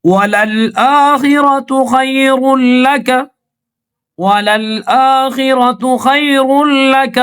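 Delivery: melodic measured tilawat; Quran recitation. Acted. Teacher recites the surah verses for students to follow. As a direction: chant each verse, stretching the long vowels.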